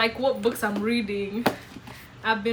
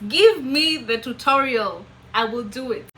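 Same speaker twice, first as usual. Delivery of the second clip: mock demand; 3 semitones higher, 10 dB louder than usual. Newcomer demanding a way to learn the American accent, loud mock demand. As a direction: demand it loudly, word by word. Acting out her eager inner demand.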